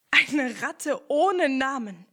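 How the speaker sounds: loud, slow astonishment; astonished. Acted. Ernie marvels at the nameless rat, loud and slow. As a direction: spell it out in amazement.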